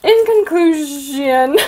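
loud, stretched-out words breaking into a laugh; slow dictation. Real, not acted. Vlogger typing her comment aloud, slow mock-grand dictation. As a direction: drag it out as you type.

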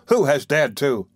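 outraged on her behalf; indignant exclamation. A narrator's reading. Beadle exclaiming indignantly at whoever upset the matron. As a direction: demand to know the culprit. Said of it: "exclaimed Mister Bumble".

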